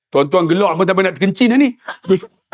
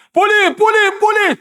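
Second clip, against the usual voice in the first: calling out to police; 11 semitones higher than usual. Retold or imitated. Lost uncle calling the police.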